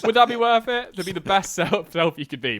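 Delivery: loud, high-pitched protest; shouted mock disbelief; incredulous outburst. Loud mock-incredulous objection over laughter.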